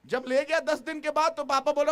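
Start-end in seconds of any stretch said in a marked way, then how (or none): none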